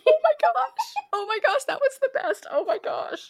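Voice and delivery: high, loud, squealing delight; thrilled squeal. Real, not acted. Fan gushing over cat's sneezing pictures, squealing delight. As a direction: gush over the cat photos, voice way up. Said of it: "I love your passion".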